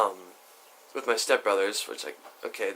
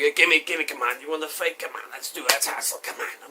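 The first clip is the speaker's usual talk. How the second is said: put-on drunk taunting; 4 semitones higher than usual. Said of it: "He's like".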